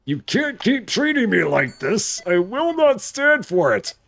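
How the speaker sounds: high, mock-outraged protest; hammed-up worker storming off in indignation; rage quitting employee. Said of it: "He's gonna be like".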